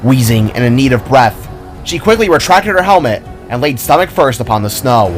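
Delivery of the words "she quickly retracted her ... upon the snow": plain reading voice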